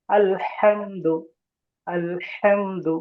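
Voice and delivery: very slow, "আলহামদু" with a breathy throat ha; throaty ha. Shown as a demonstration. Teacher slowly modelling the throat ha in "আলহামদু" for students. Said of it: "একটু অস্বাভাবিক উচ্চারণ হবে".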